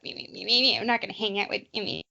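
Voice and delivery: quiet, sulky grumbling under the breath; a mild mock grumble. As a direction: grumble like a jealous kid who feels left out. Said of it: "grumbling about, like".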